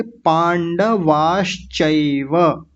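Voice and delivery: पांडवाश्चैव very slow, half श held; slow syllable-by-syllable recitation. Shown as a demonstration. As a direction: break पांडवाश्चैव into clear parts. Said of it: "मैं थोड़ा इसको खोल देता हूँ".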